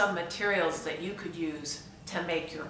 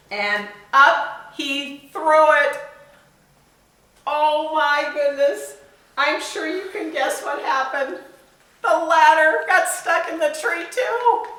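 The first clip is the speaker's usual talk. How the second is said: amazed; 8 semitones higher, 5 dB louder than usual. Acted. Teacher reading to children about the ladder, amazed and laughing. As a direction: marvel at the silly mishap.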